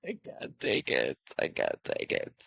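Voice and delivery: soft, low, whispered despair; at wit's end. Real, not acted. Streamer must reconnect the iPad, whispering at wit's end. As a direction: whisper it to yourself, at breaking point. Worn down by tech failures.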